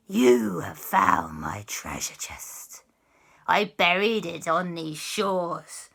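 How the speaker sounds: slow, theatrical pirate-witch voice; pirate ghost captain, witchy. Acted. Pirate ghost claims his lost treasure, slow menace.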